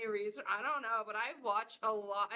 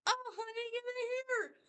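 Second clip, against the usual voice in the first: high sugary mock of the boyfriend's mom, much higher; imitating a doting mom, pitched way up; 11 semitones higher than usual. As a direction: play his mother, sweet and high, calling her son in. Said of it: "And his mom's just like".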